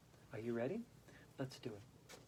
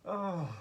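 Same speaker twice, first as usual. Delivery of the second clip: an "oh" into rolling laughter; exercise laughter. Shown as a demonstration. Yoga teacher demonstrating laughter for the class, deliberate laughing. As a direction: sigh an oh, then laugh it out. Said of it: "with laughter".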